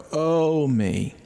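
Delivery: long moan, high then dropping; drawn-out, sinking groan of pain; imitated pained moan. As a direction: imitate an old man groaning at each step. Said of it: "with each step in pain".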